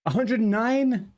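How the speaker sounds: high, slow, disbelieving question; can't believe the number; incredulous. Real, not acted. Incredulous at friend's kill count.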